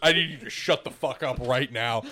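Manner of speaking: low, firm mock reprimand; stern shutdown. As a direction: act out a friend cutting you off. Acts friend's stern shutdown.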